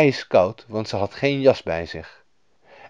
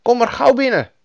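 Lapland woman; 13 semitones higher, 6 dB louder than usual. A narrator's reading. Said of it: "zei de vrouw tegen Gerda".

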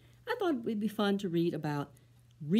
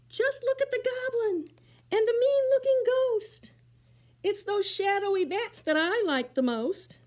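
put-on much higher, louder thrilled kid; excited monkey; 7 dB louder than usual. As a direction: gush over spooky pictures.

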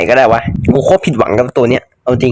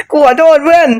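high apology through laughter; real laughing apology to a friend; 14 semitones higher than usual. Real, not acted. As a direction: say sorry while cracking up. Blew up his ally by accident.

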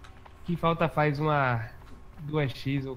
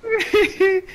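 loud high laugh; 9 dB louder than usual. Real, not acted. Teammate's boast.

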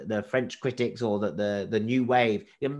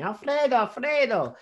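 put-on high-pitched street call of a kid, a bit louder; much higher, carrying kid's call for a friend; urchin calling out; 12 semitones higher, 4 dB louder than usual. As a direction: call the name down the street like a scruffy Italian urchin. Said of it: "scruffy urchins running through the street going".

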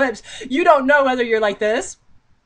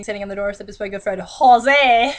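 normal talking voice

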